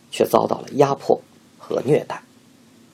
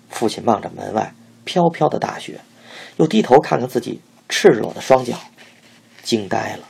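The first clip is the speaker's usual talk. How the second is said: ordinary delivery, nothing marked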